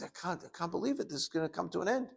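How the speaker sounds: high, soft voice of retold dying thoughts; stunned disbelief. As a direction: softly act out a dying man's disbelief.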